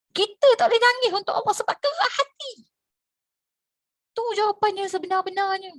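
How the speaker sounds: high, loud scolding preacher's declaration; a high, loud declaration that hard hearts are the problem. Real, not acted. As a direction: call out the hard-hearted congregation.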